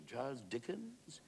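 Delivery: Wilde's drawn-out disbelief; incredulous echo. Acted. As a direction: draw the name out as a surprised question. Wilde echoes the name as question.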